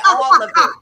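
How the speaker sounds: loud, emphatic "All of it."; firm insistence. Retold or imitated. Wife retelling her firm answer to her husband, loud and emphatic. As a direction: put your foot down, loud and sure.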